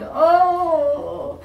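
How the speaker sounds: high, far moan of toothache; pained moan. Acted. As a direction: let out a short aching moan. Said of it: "The woman moaned".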